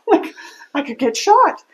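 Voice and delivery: half-laughing, pitch jumping up; amused disbelief, rising high. Retold or imitated. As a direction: let a laugh break into the words. Nervous moment retold with laugh.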